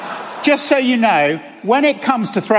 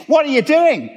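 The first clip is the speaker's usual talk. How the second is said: put-on a light Australian accent, pitched up in disbelief; Aussie accent; 9 semitones higher than usual. An old Aussie clergyman asks the swimmer, in a light Australian accent. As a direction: imitate the Aussie clergyman's startled Australian question. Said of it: "he said in his Aussie accent".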